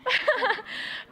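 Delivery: high, breaking laugh through tears; teary laugh. Real, not acted. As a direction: let a teary laugh escape.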